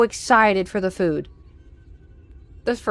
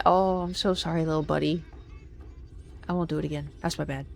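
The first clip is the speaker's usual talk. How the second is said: soft, lower coo to a tiny pet; tender apology; 4 semitones lower, 11 dB quieter than usual. Real, not acted. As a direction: apologize gently to a frightened mouse. Apologizing to a mouse.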